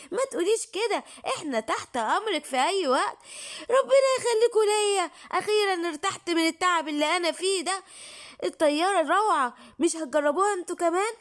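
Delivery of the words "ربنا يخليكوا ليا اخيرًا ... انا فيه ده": crying with relief, voice pitched up; tearful thanks. Acted. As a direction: sob out the thanks, overwhelmed. Old witch thanks, relieved.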